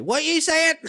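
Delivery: high, loud shout of "What you saying?"; shouting over music. Retold or imitated. Girl at the bar shouting back over loud music. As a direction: yell it over the nightclub noise.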